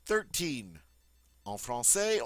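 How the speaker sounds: ordinary talk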